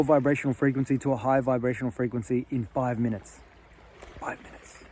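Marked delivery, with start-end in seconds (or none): 4.2-4.8 hushed, voiceless aside; barely audible whisper. Real, not acted. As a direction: whisper it softly as a quiet reminder. Whispered repeat of key point.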